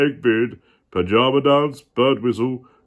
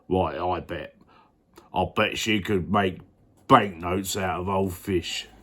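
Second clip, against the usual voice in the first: low, proud dad boasting; boastful miller voice; 6 semitones lower, 7 dB quieter than usual. Acted. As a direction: brag like a puffed-up father.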